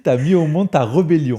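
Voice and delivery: laughing repeat. Retold or imitated. Host repeating the guest's punchline, laughing. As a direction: savour the line through laughter. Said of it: "C'est beau, ça".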